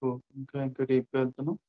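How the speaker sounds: slow, drawn-out countdown with long silences; guided meditation. Acted. Count listeners down into deep relaxation, guided meditation.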